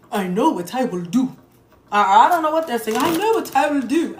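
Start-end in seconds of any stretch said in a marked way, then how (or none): 0.1-1.4 lightly put-on odd accent, lower "I know what I will do"; mock unplaced accent. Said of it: "I don't know what accent this bitch is in". 3.0-4.2 higher put-on odd accent, "I know what I will do" lilting up; mock unplaced accent. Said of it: "I don't know what accent this bitch is in".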